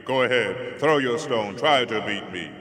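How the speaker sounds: booming, goading giant; loud giant taunt. Acted. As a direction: dare him loudly like a giant. Giant goads the boy loudly.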